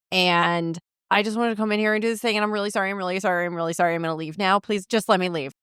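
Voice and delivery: rapid-fire mock grovelling; hurried apology. Imagining herself apologizing to the angry homeowner, hurried mock apology. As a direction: babble the excuse in one breathless rush. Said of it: "No, I'd be like".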